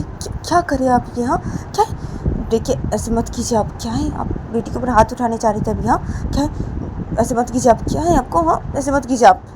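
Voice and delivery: high, shocked protest; alarmed Payal. Acted. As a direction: step in urgently to stop her. Plays Payal stopping Poonam's raised hand.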